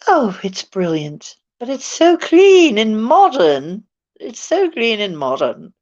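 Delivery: mock-enthusiastic English accent, swooping pitch. Selling radiation as lovely, in a put-on English accent. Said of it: "saying that in an English accent".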